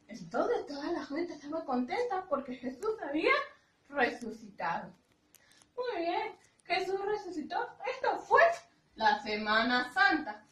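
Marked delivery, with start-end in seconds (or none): none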